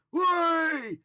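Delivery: very loud, high-pitched whooping shout; high scream. Shown as a demonstration. Ghost hunter testing for animals in the woods, piercing scream. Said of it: "or me screaming".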